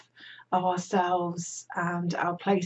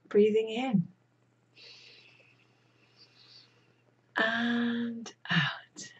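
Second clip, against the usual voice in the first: slow breath count with long pauses; guided breathing; 2 semitones higher, slower than usual. Real, not acted. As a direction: lead the breaths, drawing out "and", whispery on the exhale. Leads breathing exercise.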